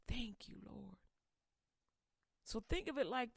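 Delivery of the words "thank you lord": a soft, breathy whispered "thank you". Acted. Enos' prayer ends in a hush.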